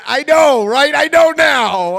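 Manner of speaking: loud, high exclamation to the crowd; emphatic shouted agreement. Real, not acted. Comic agreeing with the crowd's reaction, shouting. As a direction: shout it, stretching the last word.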